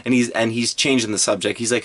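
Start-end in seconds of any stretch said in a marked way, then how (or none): none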